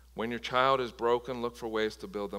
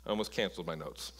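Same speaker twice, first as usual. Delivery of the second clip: laughing aside; 6 semitones lower, 7 dB quieter, faster than usual. Real, not acted. Laughing aside about his notes.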